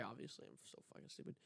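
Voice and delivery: ordinary delivery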